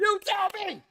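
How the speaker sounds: very high, loud teasing squeal; squealed retort. Real, not acted. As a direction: throw it back with a squeal.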